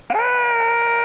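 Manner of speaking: sudden high yelp of fright; real startled shriek; startled scream. Real, not acted. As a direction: jump and shriek in surprise. Startled by a talking fish.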